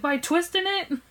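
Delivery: high disbelieving question; incredulous. Retold or imitated. Incredulous at dad.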